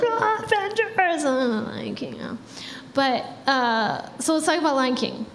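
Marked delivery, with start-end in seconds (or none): 0.0-1.2 high-pitched, drawn-out mock cheer; excited fans. Acting out fans cheering for Avengers. 1.2-2.4 hushed mock half-hearted cheer; unenthusiastic fans. Acting out fans' letdown at Lion King.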